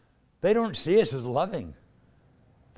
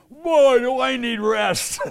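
higher, louder, slow mock yell; retold yell; 8 semitones higher, 8 dB louder, much slower than usual. Taxi driver yelling back at the preacher, slow loud mock yell. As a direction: belt it out like the frantic driver. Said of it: "he yells with the same intensity".

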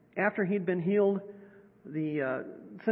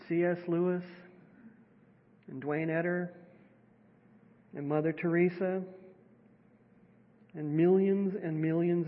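guided meditation; 2 semitones lower than usual. Acted. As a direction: gently guide them into stillness.

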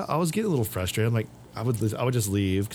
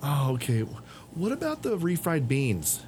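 clueless customer again; 5 semitones higher, slower than usual. Retold or imitated. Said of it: "the third one".

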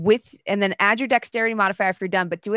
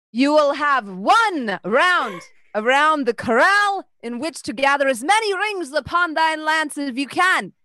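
herald voice; 7 semitones higher, 3 dB louder than usual. Acted. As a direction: proclaim the rules like a herald. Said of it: "What happened to your accent?".

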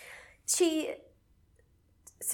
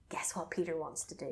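hushed tease; 3 semitones lower, 9 dB quieter than usual. A narrator's reading. Building suspense to listener.

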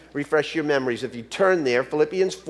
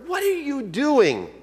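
higher, slow, puzzled question; exasperated parent; 9 semitones higher than usual. Retold or imitated. Parents ask their grinning daughter, mildly exasperated.

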